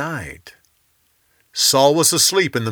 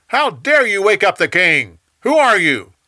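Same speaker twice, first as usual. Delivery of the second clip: indignant shout, higher and louder; yelled back; 7 semitones higher, 6 dB louder than usual. A narrator's reading. Abner shouts back at David for waking the king, indignant yell. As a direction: bark it back across the valley, outraged. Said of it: "then yelled back".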